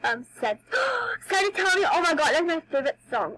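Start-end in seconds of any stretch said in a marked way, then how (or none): 0.6-1.2 sudden high gasp of delight; sharp gasp. Real, not acted. Gamer spotting her favorite song, sharp excited gasp. 1.3-3.3 high, loud thrilled outburst; excited. Real, not acted. Girl finding her favorite song, thrilled and loud.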